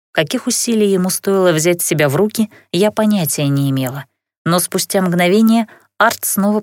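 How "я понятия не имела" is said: usual speaking voice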